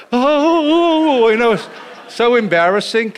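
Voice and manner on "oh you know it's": a high, loud, embarrassed yelp. Retold or imitated. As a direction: cringe out loud at the scene. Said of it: "when the whites came, it was like".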